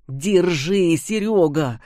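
strained, irritated call; irritated rasp. Acted. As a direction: snap it out under the weight. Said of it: "раздражённо прохрипел Антипов".

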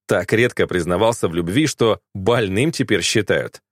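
ordinary delivery, nothing marked

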